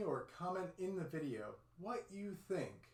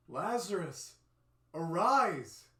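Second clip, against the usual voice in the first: loud, ringing command; commanding call; 3 semitones higher, 10 dB louder, much slower than usual. Acted. Jesus calls dead Lazarus out of the tomb, loud command.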